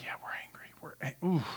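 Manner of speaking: hushed, breathy whisper of grudging agreement; Pharisees' whisper. Retold or imitated. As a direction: whisper it like a muttering crowd at the back. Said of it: "the Pharisees, they're like".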